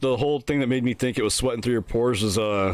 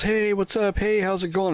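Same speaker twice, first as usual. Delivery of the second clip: high, breezy hallway hellos; cheery greeting; 9 semitones higher than usual. Retold or imitated. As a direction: greet coworkers like nothing's wrong. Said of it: "I'm walking down the halls".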